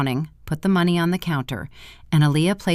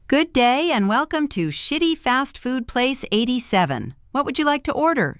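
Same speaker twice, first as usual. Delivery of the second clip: bored cashier reciting the greeting, higher and quick; monotone voice; 9 semitones higher than usual. Acted. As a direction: rattle off a tired scripted welcome. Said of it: "in a monotone voice".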